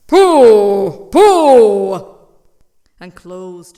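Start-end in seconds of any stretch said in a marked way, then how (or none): 0.1-2.1 scoffing. Acted. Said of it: "So he said".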